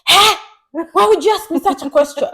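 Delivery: high, loud mock outrage; indignant boyfriend. Girlfriend mimics boyfriend reacting to her question, indignant.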